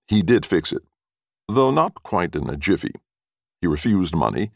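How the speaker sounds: ordinary delivery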